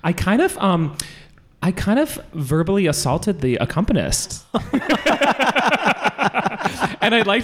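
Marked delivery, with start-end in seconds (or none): 4.5-7.0 high embarrassed burst of laughter; nervous giggle at yourself; sheepish laugh. Real, not acted. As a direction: laugh it off, a bit mortified. Confessing an awkward audition.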